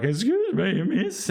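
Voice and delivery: breaks up into a high squeak; voice cracking high. Retold or imitated. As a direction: let the voice crack upward on miss. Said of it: "my voice started to break".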